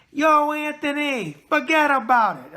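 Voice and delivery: stretched, high call to his cousin; hearty New York shout-out; loud shout-out. Real, not acted.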